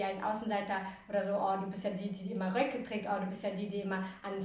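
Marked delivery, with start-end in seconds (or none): none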